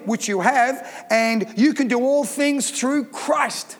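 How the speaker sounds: ordinary delivery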